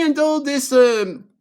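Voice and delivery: normal talking voice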